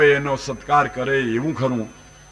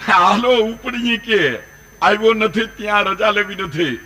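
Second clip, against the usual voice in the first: higher, louder mock panic; comic alarm, pitched up and loud; alarmed urging; 5 semitones higher, 4 dB louder than usual. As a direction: urge a getaway in a raised voice. Alarmed monk urges leaving at once.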